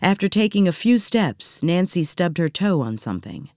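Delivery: ordinary delivery, nothing marked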